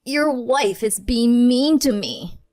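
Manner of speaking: mother-in-law tattling to her son, slow and whiny; tattling whine. Retold or imitated. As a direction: whine it like a child telling tales. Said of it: "tattle to Dwight".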